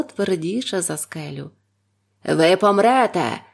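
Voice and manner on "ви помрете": slow, loud stern threat; Bluebeard's stern sentence. A narrator's reading. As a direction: pronounce a death sentence coldly. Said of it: "суворо сказав він".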